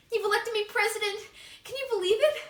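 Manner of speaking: excited squeal. Acted. Gushing over election win.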